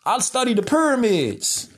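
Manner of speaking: a hint of a proud expert boasting; mock smug scholar. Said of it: "Where they be like".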